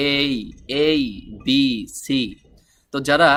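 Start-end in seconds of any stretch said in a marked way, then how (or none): 0.1-2.2 letter A drawn into gliding এই; common mispronunciation. Retold or imitated. Teacher mimics learners reciting the alphabet, mispronounced A. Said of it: "অনেকে এ না বলে কি বলে?".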